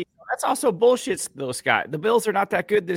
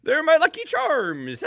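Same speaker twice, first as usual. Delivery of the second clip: leprechaun attempt; 7 semitones higher, slower than usual. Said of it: "Give me a leprechaun telling you".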